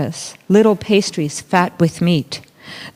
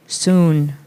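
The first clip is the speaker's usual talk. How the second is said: calm uncle; 4 semitones lower than usual. Acted. Uncle answers children.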